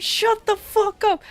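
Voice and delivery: imitating a neighbor yelling, pitched high and slow; slow, high mock yell from a window; retold yell. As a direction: stretch it out like a man yelling from his window. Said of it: "And he just yelled".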